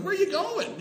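high, loud call after someone; taunting call. Retold or imitated. Teacher calling after the fleeing superintendent, taunting call. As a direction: holler it after him, goading.

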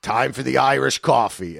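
lightly imitated dad's loud announcement; mock round-calling; retold dad. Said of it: "And then he'd be like".

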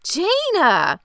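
high, loud, falling call of a name; drawn-out high yell; mock yell. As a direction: imitate yelling to shift the blame.

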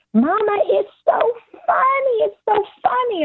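put-on very high, gleeful little-girl voice; imitated giggly toddler. Toddler at the table to mama, mock hysterical glee. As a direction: mimic a toddler tickled by something, squealing high. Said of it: "starts laughing uncontrollably".

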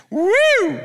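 high loud woo; excited whoop. Real, not acted. Preacher to congregation, a joyful whoop. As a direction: whoop with joy. Excited shout after psalm.

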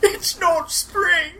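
high, drawn-out whine; hammed-up wailing. Orc bemoans his new blue colour, drawn-out wail. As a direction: stretch it into a slow, high lament.

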